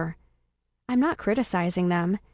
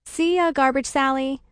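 higher, lightly called goodbye; mild, sing-out call; 4 semitones higher than usual. A narrator's reading. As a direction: call a casual farewell across a distance. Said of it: "I call out".

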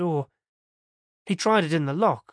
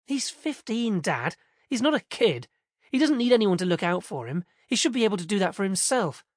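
put-on higher, whiny teen daughter arguing; exasperated teenage girl; 6 semitones higher than usual. A narrator's reading. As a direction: complain like a fed-up teenager. Narrator voices Jen, arguing again.

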